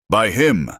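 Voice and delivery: a man accuses Ashki with a shout. A narrator's reading. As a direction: shout the blame from the crowd. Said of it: "a man shouted".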